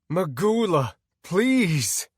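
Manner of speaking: loud, high cry for help; scream. Acted. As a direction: scream desperately for rescue. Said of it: "I screamed when I respawned".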